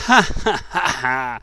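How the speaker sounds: loud, mocking ha-ha-ha; wild laughter. A narrator's reading. Beggars laugh at the little king, loud wild laughter. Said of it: "Wild laughter followed".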